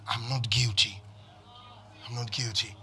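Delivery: low, soft personal confession; hushed, measured self-assurance; quiet affirmation. Shown as a demonstration. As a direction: say it quietly to yourself.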